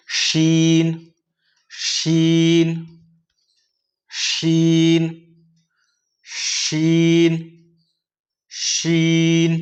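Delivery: शीन drawn out slowly with a long sh; teacher drilling big sheen slowly; slow drilled sheen. Shown as a demonstration. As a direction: stretch शीन, sh hissed with air. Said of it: "बड़ी शीन को किस तरह से अदा करा जाएगा".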